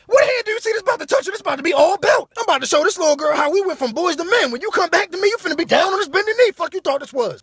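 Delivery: high, loud threatening rant; worked-up shouted comeback; acted angry tirade. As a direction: threaten a whooping at full pitch.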